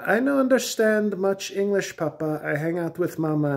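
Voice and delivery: put-on high, loud, broken-English European teen; silly European accent. Stepson answering Papa in a silly European accent. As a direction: play the tall kid in a silly European accent. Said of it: "silly European accent".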